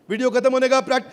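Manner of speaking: ordinary delivery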